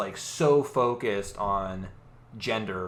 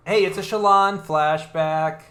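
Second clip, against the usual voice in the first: excited announcement; 7 semitones higher, 7 dB louder, slower than usual. Real, not acted. Announcing new chapter.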